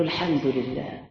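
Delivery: low, soft, forced-out mumble; reluctant muttered praise; grudging thanks. Retold or imitated. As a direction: squeeze the words out unwillingly. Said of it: "انطقها بالعافية بقى".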